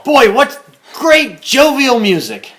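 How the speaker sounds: slow, high, hammed-up praise; mock enthusiastic. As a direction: overdo the fake delight. Mock enthusiastic radio host.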